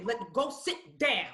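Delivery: slow, firm mom order; a stern mom telling her toddler to sit; stern command. Retold or imitated. Slow firm mom order. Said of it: "I would say".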